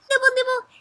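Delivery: tiny high nibbling voice; squeaky-high playful nibbles; bunny nibbling. Acted. Imitates a bunny eating.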